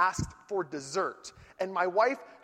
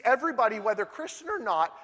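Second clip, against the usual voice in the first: normal talking voice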